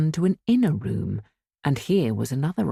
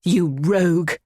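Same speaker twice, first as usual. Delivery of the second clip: indignant; 3 semitones higher, 4 dB louder than usual. A narrator's reading. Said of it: "she said".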